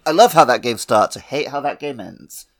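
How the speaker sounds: normal talking voice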